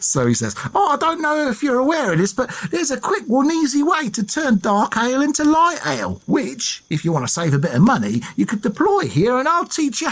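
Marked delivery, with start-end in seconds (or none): 0.7-2.3 full hammed-up Essex accent, much higher and quick. Dick Turpin sweet-talking the landlady, hammed-up high Essex accent. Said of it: "I'm doing an Essex accent". 2.3-10.1 hammed-up Essex accent, much higher; a cheeky Essex rogue selling a trick, pitched high. Dick Turpin conning the landlady, high hammed-up Essex accent. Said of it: "I'm doing an Essex accent".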